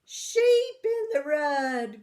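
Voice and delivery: loud, high, slow cry of alarm; grandma crying out in alarm; alarmed call. Acted. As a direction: call out the trouble like a startled grandma. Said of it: "Grandma said".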